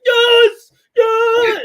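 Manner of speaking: high, loud yell of joy; excited yell. Retold or imitated. Imitating a fan shouting yes in the hallway. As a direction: yell it like a fan whose team just won. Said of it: "we just hear Billy in the hallway".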